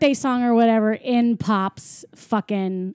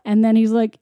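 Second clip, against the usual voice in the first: normal talking voice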